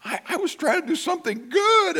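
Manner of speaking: very high, wounded protest; hammed-up self-pity; mock whimpering. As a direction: protest in a squeaky hurt voice. Hurt pastor protesting, retold.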